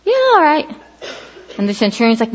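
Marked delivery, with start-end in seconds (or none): 0.0-0.7 offhand agreement. Pretend. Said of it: "He's like".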